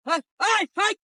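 loud high shout; calling out. Acted. Man shouts across to someone, loud and high.

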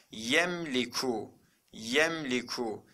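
"Yemliku" slow, no vowel between M and L; model reading. Shown as a demonstration. Teacher shows students how to read it, slow model reading. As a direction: draw out "Yemliku" carefully. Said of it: "nasıl okuyacağız bunu?".

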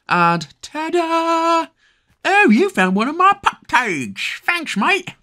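very high cartoon puppy voice; high cartoon pup thanking the player; squeaky pup voice. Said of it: "if they all spoke like that?".

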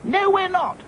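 very high, sharp retort; snapped. A narrator's reading. Dotty snaps back at the frightened dolls. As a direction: cut the panic off sharply. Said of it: "snapped Dotty".

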